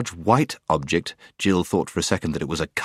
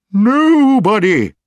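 very high, slow, full-volume hoot; owl shouting; 14 semitones higher, 9 dB louder, much slower than usual. A narrator's reading. Owl making a deaf dwarf hear, shouting. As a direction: bellow it out long like a hooting owl. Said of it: "you needn't shout".

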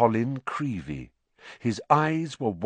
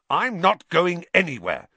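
firm, raised-pitch defiance; fierce; 6 semitones higher, 4 dB louder than usual. A narrator's reading. Boy refuses the elf's plea to leave, fiercely. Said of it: "said Harry fiercely".

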